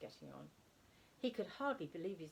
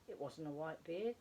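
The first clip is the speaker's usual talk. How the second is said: usual speaking voice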